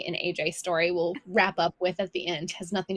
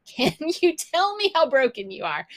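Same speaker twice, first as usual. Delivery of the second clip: high, louder pleading question; mock exasperated question; 8 semitones higher, 5 dB louder than usual. Moms asking screaming kid about injury, mock exasperated. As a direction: plead for a straight answer, half joking.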